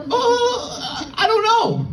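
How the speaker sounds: put-on a flustered ten-year-old blurting it out with a laugh; panicked kid. Kid in the barber chair answers the stylist, high and panicked.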